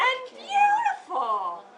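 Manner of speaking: high, loud, slow swoon on beautiful; sweeping, hammed-up praise; big dramatic emphasis. A narrator's reading. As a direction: gush it out with wide-eyed drama. Teacher reads story dramatically.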